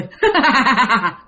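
eerie chuckle; spooky imitated laugh; creepy laugh. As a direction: laugh like the grinning stranger. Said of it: "Cứ cười vậy".

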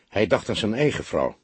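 usual speaking voice

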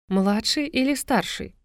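dry smirk, slow and high. A narrator's reading. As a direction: toss it back with a smirk. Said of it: "усмехнулась блондинка".

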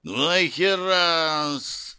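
slow, drawn-out demon growl; monster roar. Acted. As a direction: roar it like a wounded beast. (рычание чудовища)

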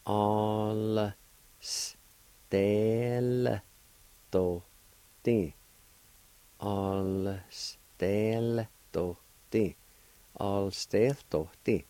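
drawn out very slowly for learners; teacher modelling the word slowly; slow careful pronunciation. Shown as a demonstration. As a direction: stretch every syllable of Alstelti. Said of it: "This is pronounced like this".

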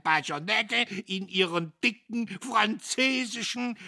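high, loud, excitable storyteller; puffed-up professor, shrill with excitement; boastful old professor. A narrator's reading. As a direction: crow the war tale at a high pitch. Professor Kühnchen tells war tale.

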